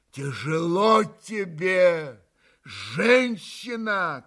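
slow, loud anguished shout; unrestrained outburst. A narrator's reading. Mitya bursts out at Katya, slow loud cry. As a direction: let it burst out of you. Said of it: "безудержно вырвалось вдруг у Мити".

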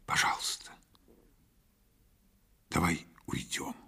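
very soft, breath-only begging; whispered plea. A narrator's reading. Mother pleading to leave the show, whispering. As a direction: whisper it haltingly, with a long pause.

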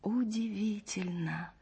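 soft, slow inward wonder; hushed, marvelling musing; thinking to oneself. A narrator's reading. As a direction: breathe it slowly, as a private thought. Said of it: "подумала Маша".

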